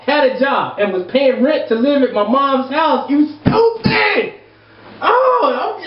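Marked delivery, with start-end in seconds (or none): 3.1-5.9 high, slow yell of "stupid"; outraged shout. Real, not acted. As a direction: drag it out in disbelief. Ranting at letter writer.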